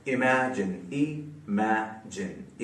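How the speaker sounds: Imagine very slow and soft, in three pieces; syllable by syllable. Shown as a demonstration. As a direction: break imagine into i, ma, jin with gaps. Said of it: "तीन syllables वाला word". (Imagine को तीन हिस्सों में रुक-रुक कर)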